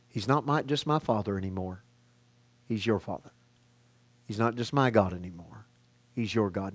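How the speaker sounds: soft, low, unhurried voice; tender reassurance. Acted. Risen Jesus telling followers of their Father, tender reassurance. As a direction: hush it down to share intimate good news.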